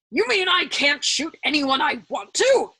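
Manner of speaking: slow, loud, indignant; indignant demand. A narrator's reading. General demanding in indignation, slow and loud. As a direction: demand it in outrage. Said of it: "demanded with uncompromising indignation".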